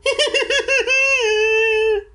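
very high-pitched giggling fit; fit of laughter. A narrator's reading. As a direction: burst into squealing, acted laughter. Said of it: "broke into a fit of laughter".

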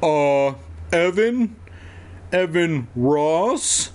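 hesitant call, drawn out, questioning. A narrator's reading. Evan answering the officer below, tentative drawn-out call. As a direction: call your name down slowly, unsure. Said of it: "Evan called down".

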